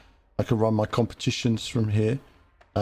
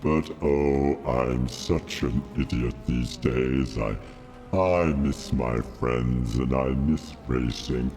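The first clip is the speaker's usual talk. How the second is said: Darth Vader voice; 8 semitones lower than usual. Acted. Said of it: "little bit of Vader".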